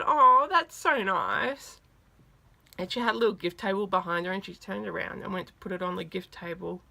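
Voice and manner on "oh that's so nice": high, loud, drawn-out appreciation; touched gushing. Retold or imitated. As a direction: imitate someone touched by a small gift, stretched slowly. Idol touched by gift, retold.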